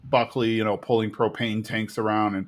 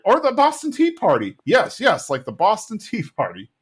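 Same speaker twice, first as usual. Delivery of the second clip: high-pitched eager agreement; mock excitement; 10 semitones higher, 3 dB louder than usual. The guys agreeing on their protest, mock-excited. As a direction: imitate pals piling onto an idea.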